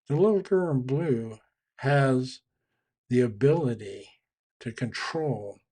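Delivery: ordinary talk, nothing marked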